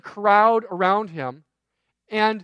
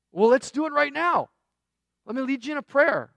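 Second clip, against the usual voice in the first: higher-pitched, keen urging; retold eagerness, pitched up; eager offer; 5 semitones higher than usual. Acted offer to pray right away.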